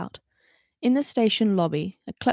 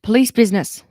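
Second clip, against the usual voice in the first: gruff push through a crowd, louder; grunted; 2 semitones higher, 8 dB louder than usual. Acted. Holly pushing through angry protesters, gruff and louder. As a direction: grunt it out while shoving past. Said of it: "she grunted".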